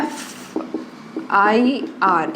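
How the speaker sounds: एफ़ आई आर stretched out extremely slowly; very slow sound-out. Shown as a demonstration. Teacher demonstrates the sound to students, very slowly. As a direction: linger on every letter of एफ़ आई आर. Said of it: "इस तरह का sound आ रहा है".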